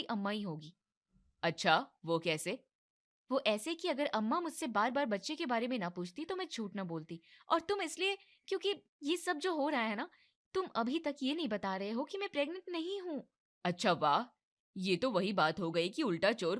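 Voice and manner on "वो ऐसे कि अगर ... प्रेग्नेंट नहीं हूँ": higher blaming voice; complaining young wife. Acted. Wife blames husband and mother-in-law, higher and complaining. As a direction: pin it all on him.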